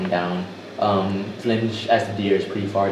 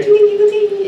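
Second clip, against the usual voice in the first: high tinkling ting ting; bell tings; 7 dB louder than usual. Acted. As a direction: ring it out high like tiny bells. GM voicing bell sounds.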